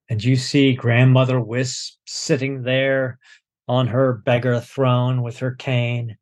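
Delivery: usual speaking voice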